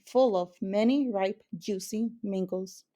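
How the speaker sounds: ordinary delivery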